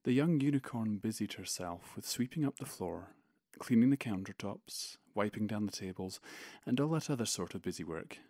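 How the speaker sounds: measured storybook reading voice; story narration. Acted. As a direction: read it like a bedtime tale. Reads his own fic aloud.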